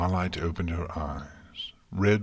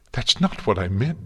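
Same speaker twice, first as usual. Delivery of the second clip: higher, louder denial; distressed protest; 4 semitones higher, 6 dB louder than usual. A narrator's reading. Celestia protesting to a hurt Twilight, distressed. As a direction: protest urgently with a lifted pitch.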